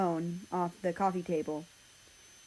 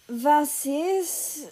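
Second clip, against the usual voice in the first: curious German question; 7 semitones higher, 4 dB louder than usual. Acted.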